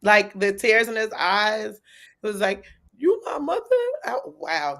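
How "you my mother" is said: a touch of a voice cracking on the question. Retold or imitated. Fan imitating the son asking his mother, cracking. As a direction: lightly imitate his voice breaking. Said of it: "the crack in his voice".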